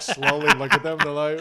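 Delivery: normal talking voice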